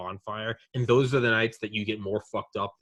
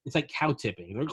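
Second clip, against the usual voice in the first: usual speaking voice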